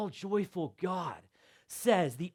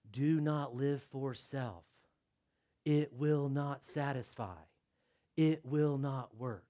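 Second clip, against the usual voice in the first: slow, soft and lower; solemn hush; 5 semitones lower, 6 dB quieter, slower than usual. Shown as a demonstration. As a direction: read it like a quiet warning. Hushed imagined warning label.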